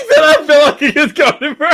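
high, squealing laughter through the words; laughing hard. Real, not acted. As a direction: crack up while trying to talk. Cracks up at co-host's answer.

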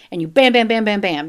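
loud punchy bams, mimed hits; mock stabbing sounds. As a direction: bang out each bam like a blow. Acting out repeated stabbing.

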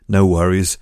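usual speaking voice

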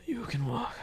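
breathy menacing whisper; spooky hushed threat; creepy whisper. Acted.

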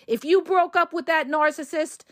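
normal talking voice

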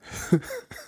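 a squeaky high laugh; amused squeak of laughter. Real, not acted. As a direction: let a high giggle slip out. Laughs after cart rant.

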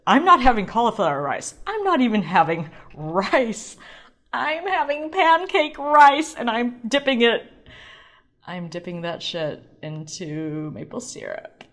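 defiant rant. Retold or imitated. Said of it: "someone be like".

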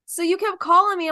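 ordinary talk, nothing marked

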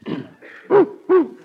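a high, loud throat clear like a dog's yelp; bark-like throat clearing. Acted. Nervous dog catcher clears his throat at Riley, high and barky. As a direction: cough out a nervous little bark. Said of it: "I always do that when I'm nervous".

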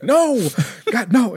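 high hammed-up cry of despair; mock anguished cry. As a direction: ham up a pleading Jesus. Jesus in the Mad TV sketch.

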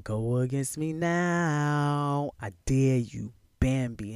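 slow, loud taunt; taunting lyric quote. Retold or imitated. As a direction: dare them, drawn out. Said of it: "quoting the lyric".

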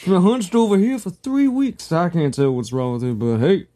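farmer voice, high then easing down; lightly voiced rustic farmer; ancient Greek voice. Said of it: "my ancient Greek voice".

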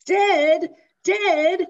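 put-on high, loud, disbelieving child; little boy's shocked cry. Little boy to grandpa, a high shocked child's cry. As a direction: cry it out like a stunned kid. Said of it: "says the little boy".

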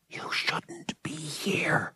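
soft, slow whisper; breathy slow whisper, barely voiced. A narrator's reading. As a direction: hush it so no one nearby hears. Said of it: "she whispered".

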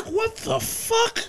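high, drawn-out squeal of disbelief; hammed-up incredulous squeal; mock disbelief. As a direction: stretch it out slowly in exaggerated, high-pitched shock. Acts out exasperated disbelief at friend.

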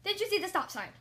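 high, loud telling-off; exasperated shouted question; scolding. A narrator's reading. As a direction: scold the learner sharply. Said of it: "Rusty scolded me".

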